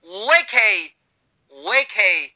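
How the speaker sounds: वेके repeated slowly as "way-kay", falling; drilling वेके for students; repeated model word. Shown as a demonstration. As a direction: say वेके twice, clearly. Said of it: "क्या कहा मैंने?".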